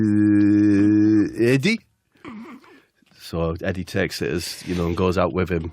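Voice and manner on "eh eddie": long held droning noise, then rising name; comic put-on drawn-out hum; Aitor Karanka. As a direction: drone on one note before the question. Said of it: "And he says".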